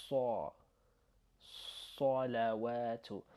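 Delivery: Sa, salawatu slow, the first syllable like saw; heavy S in salawatu. Shown as a demonstration. Teacher modelling for learners, heavy Sa and salawatu. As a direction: darken Sa toward saw. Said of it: "Try to imitate".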